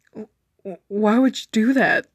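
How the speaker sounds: stammering, tearful break; words catch on the edge of tears; voice cracking. Acted. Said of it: "but my voice cracked".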